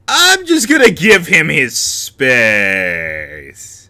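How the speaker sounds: loud, very drawn-out proclamation; hammed-up declaration. Deciding to leave Shane alone, hammed-up declaration. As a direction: belt it out slowly, stretching the last word.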